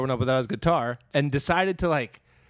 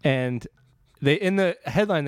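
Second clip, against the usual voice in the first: normal talking voice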